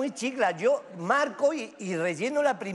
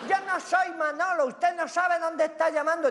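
put-on much higher young woman's voice with a foreign accent; 8 semitones higher than usual. Russian girl on the phone, in a higher young woman's foreign accent. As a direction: pitch way up to play the foreign girl. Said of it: "una voz de chica joven con acento extranjero".

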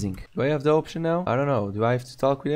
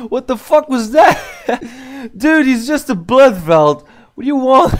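high, loud laughing disbelief; 12 semitones higher, 7 dB louder than usual. Real, not acted. Gamer cracking up at a bizarre blood vault. Laughing at absurd game event.

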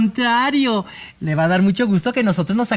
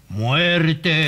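deep spirit voice; 5 semitones lower than usual. Acted. Spirit speaks through medium.

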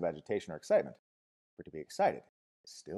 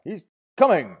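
loud, high frenzied yell; 10 semitones higher, 14 dB louder than usual. A narrator's reading. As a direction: yell it out at full voice. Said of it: "yelled Jim Bokanovsky".

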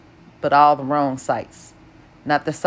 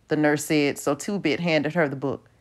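plain reading voice

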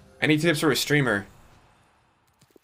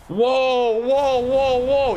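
excited whoa; 7 semitones higher, much slower than usual. Real, not acted. Reacting to another good pull.

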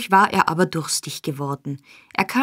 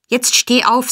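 raised, louder order; commanding; 6 semitones higher, 5 dB louder than usual. A narrator's reading. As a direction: order the husband to obey. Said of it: "sagte Frau Bumble im Befehlstone". (im Befehlston)

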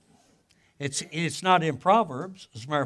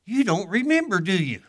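teasing challenge; 5 semitones higher, 5 dB louder than usual. Retold or imitated. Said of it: "I said".

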